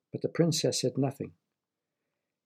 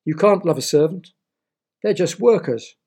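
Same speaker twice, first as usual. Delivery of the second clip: sneering; 5 semitones higher, 7 dB louder than usual. A narrator's reading. Suitor sneers at servant.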